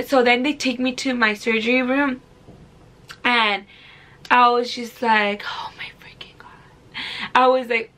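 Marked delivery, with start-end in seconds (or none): 5.5-6.5 whispered, awed dread; scared whisper. Retold or imitated. Patient taken into her surgery room, scared whisper. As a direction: whisper it under your breath in dread.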